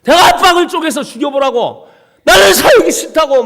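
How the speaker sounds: high, loud yell of someone who wants to die; loud anguished shout at God; despairing scream. Retold or imitated. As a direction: scream it at the sky.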